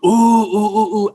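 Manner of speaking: high, loud teasing ooh; crowd oohing. Retold or imitated. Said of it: "the whole room just gets like".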